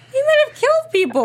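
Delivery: loud, high-pitched protest; outraged outcry. Real, not acted. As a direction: cry out in high, loud indignation. Outraged reaction to the preview.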